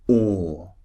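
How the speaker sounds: "or" held long and rounded, R silent; British "or", no R at the end; long or vowel. Shown as a demonstration. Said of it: "look at the shape of my mouth again".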